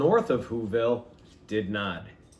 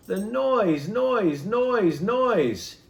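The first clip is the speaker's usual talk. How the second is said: higher and louder, building on each noise; mounting noise; 8 semitones higher, 4 dB louder, much slower than usual. A narrator's reading. Narrator mimicking the noise the Grinch hates, rising exasperation.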